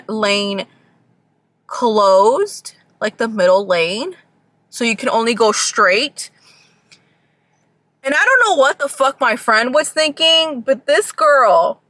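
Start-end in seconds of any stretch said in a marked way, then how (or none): none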